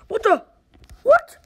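sudden high startled cry; startled yelp. Real, not acted. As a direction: jump at the fright. Startled in dark game.

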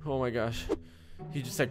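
normal talking voice

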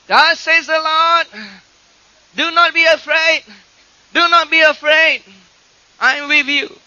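imitated girl's prophecy at full voice; teenage girl prophesying. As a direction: proclaim it high and ringing, phrase by phrase. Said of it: "God could say through a little teenage girl in prophecy".

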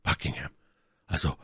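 leise und tief; gedämpfte Namensnennung. Acted. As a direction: den Namen verhalten fallen lassen. Erste, leise Nennung des Namens. (leise, tief gesprochen)

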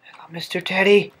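hushed whisper to a teddy; whispering while hiding. Acted. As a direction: whisper so no one hears. Sneaking thief in house.

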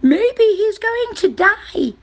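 very high, slow bird voice; chirping bird. A narrator's reading. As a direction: chirp it out slowly in a high register. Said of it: "chirped a bird".

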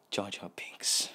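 whispering it to the side; a sneaky whispered aside. Acted.

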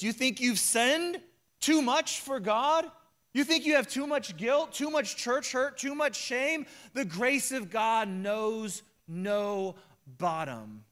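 high, loud preacher's challenge, slowing at the end; preaching at full force. Real, not acted. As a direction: drive each question home from the pulpit. Preacher pressing the congregation.

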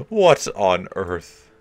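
laughing in disbelief. Real, not acted. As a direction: laugh through the words.

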